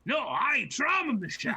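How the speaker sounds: high, loud, insistent ogre; indignant ogre head. Acted. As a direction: shout the claim like a proud brute. Other ettin head insists.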